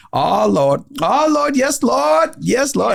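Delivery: high, ecstatic praying voice, climbing very high; fervent praying. Retold or imitated. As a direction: cry out to the Lord in rapture. Waitress praying, told with fervour.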